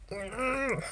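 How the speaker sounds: high, soft, strained little hum; held-back hum. Retold or imitated. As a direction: hold the joke in with a tight "mm". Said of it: "oh, it was so hard".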